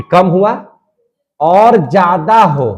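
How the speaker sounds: normal talking voice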